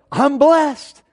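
high, drawn-out mock pious answer; exaggerated sing-out, pitched well up; gushing churchy reply. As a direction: parody someone being showily blessed. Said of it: "You know, like that".

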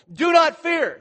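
high, loud, forceful imperative; commanding leader yelling at the crowd; yelled command. Acted. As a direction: shout it with an exclamation point. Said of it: "he's yelling at them".

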